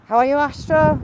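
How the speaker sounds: high and loud, calling out hello; loud cheery greeting. Real, not acted. Asking a viewer how he is, loud and bright. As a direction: sing out a big friendly hello.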